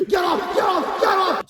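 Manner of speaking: jumping around in panic; panicked scream. Acted. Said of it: "screamed Vixen".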